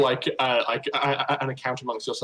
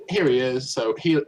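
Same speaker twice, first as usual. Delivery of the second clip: usual speaking voice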